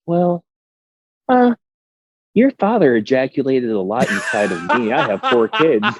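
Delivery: sweet mom voice. Retold or imitated. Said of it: "She, she'd be like".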